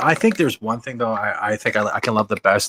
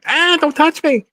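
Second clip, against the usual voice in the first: high squeaky cringing yelp; cringing squeal; 4 dB louder than usual. Retold or imitated. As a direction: shrink away with a high squeal. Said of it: "the life and tales of cringing from women".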